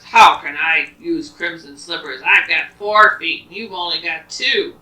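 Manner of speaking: scoffing tiger. Acted. Tiger scoffs at the boy's slippers, loud and mocking. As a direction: scoff at the silly gift.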